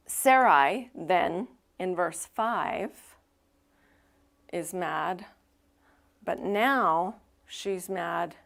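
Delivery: ordinary talk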